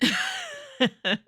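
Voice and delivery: a high, breaking laugh while upset; a sudden high laugh fighting back tears; tearful laugh. Real, not acted. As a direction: laugh through real upset. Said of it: "And I was upset".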